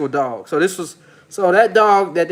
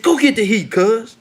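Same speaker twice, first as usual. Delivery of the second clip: put-on higher, laid-back West Coast accent like [name]; imitating [name]; 5 semitones higher than usual. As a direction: mimic a Long Beach rapper telling the homies to grab the heat. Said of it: "He said".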